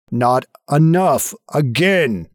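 slow, insistent voice behind the door; drawn-out, insistent command. Acted. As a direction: press each word, slow and forceful. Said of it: "louder and more insistent".

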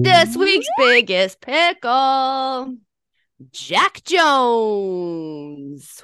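slow, drawn-out announcer reveal; slow host voice announcing this week's pickle; game show reveal. Acted.

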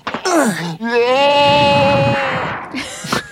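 high, crying pterodactyl screech; pterodactyl shriek. Acted. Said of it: "Your pterodactyl's voice is funny".

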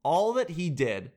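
usual speaking voice

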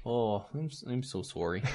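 hushed put-on Canadian "sorry"; Canadian accent. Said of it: "he's not that Canadian".

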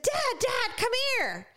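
panggilan tinggi memanggil ayah; daughters calling dad. Retold or imitated. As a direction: seru ayah ke atas dengan nada jauh lebih tinggi. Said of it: "they said".

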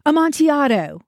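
mild narrated shout; shouted exclamation. A narrator's reading. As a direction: call it out with a falling end. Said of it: "he shouted".

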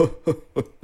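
high hammed-up jolly laugh; fake Santa laugh. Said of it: "this really fake laugh".

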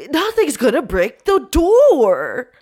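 slow, high, loud mock orc voice; orc boast. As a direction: puff up like a cocky orc guard. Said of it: "orc that comes up to Harvey is like".

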